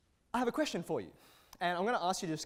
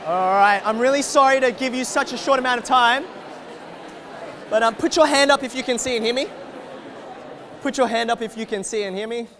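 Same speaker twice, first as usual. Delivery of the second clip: loud call over the room; 6 semitones higher, 18 dB louder than usual. Real, not acted. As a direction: raise your voice to get attention. Calling a noisy room to order.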